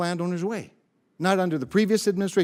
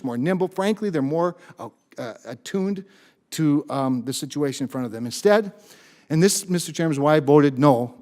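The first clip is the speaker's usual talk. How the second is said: ordinary talk, nothing marked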